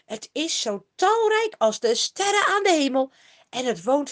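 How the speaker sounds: lofty oracle voice; solemn proclamation. A narrator's reading. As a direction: proclaim it like a prophet on a mountain. Balaam proclaims a blessing.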